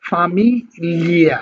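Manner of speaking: ফামিলিয়া, Italian gli sound; Italian famiglia. Shown as a demonstration. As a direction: model ফামিলিয়া for learners to copy. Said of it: "আপনারা একটু try করবেন".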